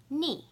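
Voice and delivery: "ni" short, falling sharply from high; fourth tone. Shown as a demonstration. Teacher showing the four tones, "ni" falling. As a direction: drop "ni" fast from the top. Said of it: "I'll say the four tones".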